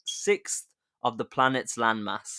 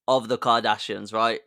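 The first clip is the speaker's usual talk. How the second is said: ordinary talk, nothing marked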